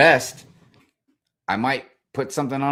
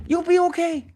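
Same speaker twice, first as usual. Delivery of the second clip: much higher, pushed "You'll be okay"; high emphatic reassurance; 3 dB louder than usual. Real, not acted. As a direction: sell it hard to the viewer. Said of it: "advising viewers loudly".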